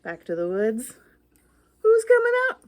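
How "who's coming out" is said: high, loud excited question; hammed-up suspense; eager suspense. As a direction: lean in, thrilled and nervous. Ghost peers at dark hole.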